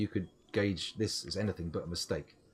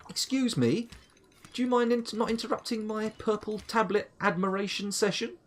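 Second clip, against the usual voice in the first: much higher, mock-offended telling-off of a critter; mock indignant; 12 semitones higher, 4 dB louder than usual. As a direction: play the outraged aristocrat interrupted. Scolds a creature that attacked.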